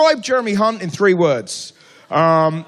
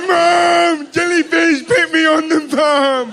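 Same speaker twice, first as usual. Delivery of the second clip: put-on childish cry for mum; 7 semitones higher, slower than usual. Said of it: "I was like".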